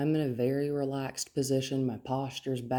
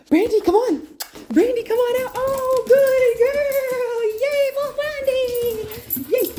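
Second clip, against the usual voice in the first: high, loud, sing-song coaxing of a dog; squealing high puppy-talk praise; excited dog praise; 8 dB louder, slower than usual. Real, not acted. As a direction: gush over the dog like she's a puppy.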